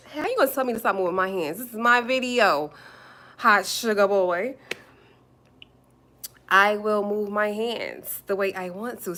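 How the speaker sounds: defiant telling-off. Real, not acted. Streamer told to stop moving her hands, defiant. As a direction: put the commenter firmly in their place.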